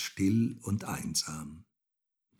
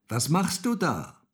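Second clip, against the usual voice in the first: raised, louder call; 6 semitones higher, 5 dB louder than usual. A narrator's reading. Häwelmann to the rooster on the tower, calling up. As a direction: call up to someone high above. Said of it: "rief der kleine Häwelmann hinauf".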